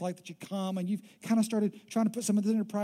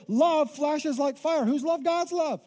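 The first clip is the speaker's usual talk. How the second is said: slow emphatic preaching; 7 semitones higher, 4 dB louder, slower than usual. Acted. Preaching climax, slow and high.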